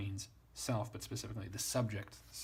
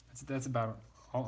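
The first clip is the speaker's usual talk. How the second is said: ordinary delivery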